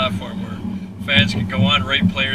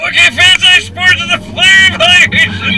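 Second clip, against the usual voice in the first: high, loud shouted pitch; practicing a speech; 8 dB louder than usual. Shown as a demonstration. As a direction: yell the pitch over the acceleration. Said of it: "let me try it again".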